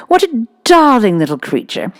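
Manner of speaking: lightly played simpering schoolmistress; Miss Minchin. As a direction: flatter the rich father, sweetly.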